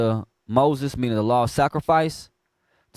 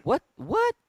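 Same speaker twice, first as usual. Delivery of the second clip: shocked what; 11 semitones higher than usual. Real, not acted. Shocked reaction to scripture.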